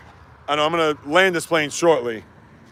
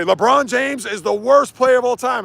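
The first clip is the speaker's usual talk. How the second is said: higher, slow, hammed-up hot take; mock clickbait claim; 8 semitones higher than usual. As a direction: overstate it like rage bait. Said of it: "that'll come out and say".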